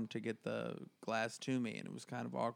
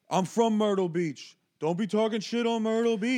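put-on loud, raised drunk heckler voice; 7 semitones higher, 6 dB louder than usual. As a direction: bark it like an offended local. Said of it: "some guy in the crowd goes".

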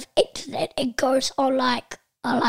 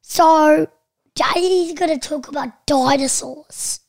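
announcing; 2 semitones higher, 7 dB louder than usual. Acted. Child announces the topic loudly.

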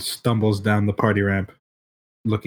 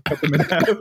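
laughing; 5 semitones higher, faster than usual. Real, not acted.

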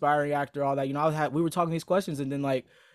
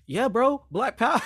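higher, mock cheer of a buddy; hyped quote; 8 semitones higher, 3 dB louder than usual. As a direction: imitate a hyped friend calling you back.

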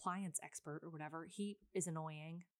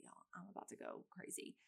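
much softer, breathless, at the end of her rope; exasperated whisper; 9 dB quieter than usual. Retold or imitated. To the contractor on the phone, an exasperated whisper. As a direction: whisper it like you're about to snap.

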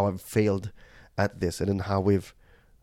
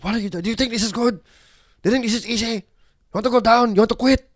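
high, louder angry scolding; raised voice; 12 semitones higher, 6 dB louder, faster than usual. Retold or imitated. Dad scolding his crying son on the hike, high angry voice. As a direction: snap at the kid in frustration. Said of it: "I raised my voice at him".

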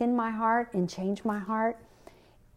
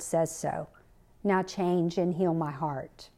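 soft, earnest prayer, mostly quieter; 4 semitones lower, 4 dB quieter than usual. Retold or imitated. Kathleen praying to God after the betrayal, soft and earnest. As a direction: pray it low and sincere, surrendering.

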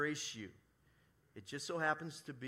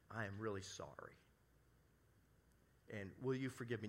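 sincere apology; 5 semitones lower, 5 dB quieter than usual. Shown as a demonstration.

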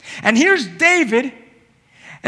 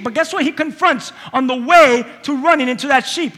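normal talking voice

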